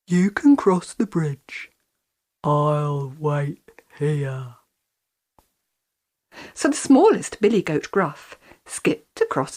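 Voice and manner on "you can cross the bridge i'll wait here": troll voice. A narrator's reading. Troll lets goat pass.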